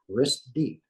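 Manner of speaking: ordinary talk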